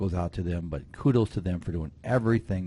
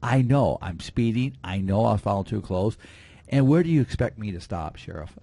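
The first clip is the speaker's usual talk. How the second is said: irritated; 3 semitones higher, 5 dB louder than usual. Retold or imitated. Sheriff retelling an irritated driver's retort at a traffic stop, irritated. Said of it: "she was so irritated".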